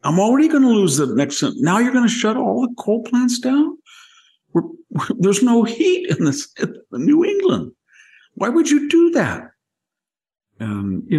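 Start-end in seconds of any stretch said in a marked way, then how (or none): 0.0-9.4 high, squeaky mock outrage; Joe Manchin, exasperated. Manchin protesting Biden shutting the coal plants, high mock outrage. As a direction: play him as a panicked senator, voice jumping way up. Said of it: "And then Joe Manchin said".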